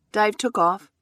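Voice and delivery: usual speaking voice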